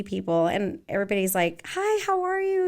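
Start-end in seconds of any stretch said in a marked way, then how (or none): 1.7-2.7 high-pitched, delighted mock hello; imitated happy greeting. Dance teacher imitates people she runs into, high excited greeting. Voices everybody greeting her happily.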